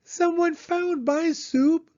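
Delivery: very high, slow sputtering squeak; high squeaky baby bear. Acted. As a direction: sputter it like a tiny upset cub. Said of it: "sputtered Baby Bear in her high squeaky voice".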